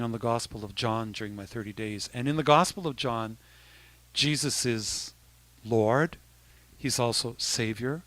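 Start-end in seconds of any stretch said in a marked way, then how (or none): none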